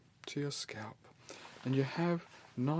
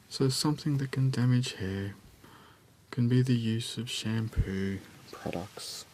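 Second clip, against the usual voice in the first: whispering; 7 dB louder than usual. Acted. Soft-spoken scalp check roleplay.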